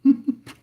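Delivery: short high laugh; burst of laughter. Real, not acted. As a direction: laugh out loud. Laughing at own slip.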